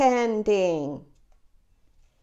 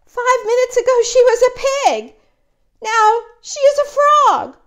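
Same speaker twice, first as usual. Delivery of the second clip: much higher and louder, panicky; alarmed; 11 semitones higher, 8 dB louder than usual. Acted. Gerald panics over Piggy's change, high loud alarm. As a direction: shout it in rising panic.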